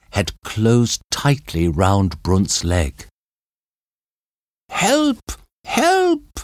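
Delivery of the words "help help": high panicked screaming for help; desperate cry for help. A narrator's reading. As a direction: cry out in terror. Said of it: "Brunt cried out".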